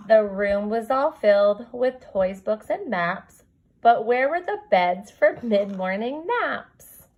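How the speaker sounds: lilting storybook read-aloud; reading to children. Acted. Teacher reading a rhyming book to her kindergarten class, storybook lilt. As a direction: read the rhyme to your class, gently bouncing.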